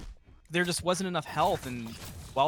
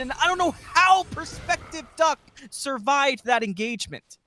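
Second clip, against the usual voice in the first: play-by-play building to a roar; rising hype, shout the big moments; fighting game announcer; 7 semitones higher, 7 dB louder than usual. Real, not acted.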